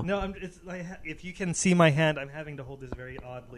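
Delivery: mic dropping in and out; fading away from the mic; voice going in and out. Real, not acted. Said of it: "your voice is going in and out".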